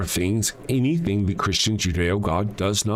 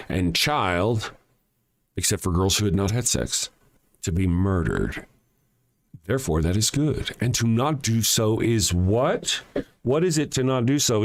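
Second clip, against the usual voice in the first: ordinary talk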